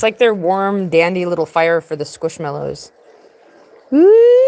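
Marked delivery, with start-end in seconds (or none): 3.9-4.4 high, loud whoop; cheer. Real, not acted. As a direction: whoop with glee. Sudden cheer.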